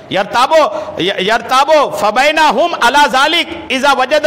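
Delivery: high, slow, ringing recitation of Arabic scripture; Arabic hadith recitation. Acted. Preacher quoting the hadith to his congregation, declaimed recitation. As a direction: declaim the Arabic text high, drawing out the words.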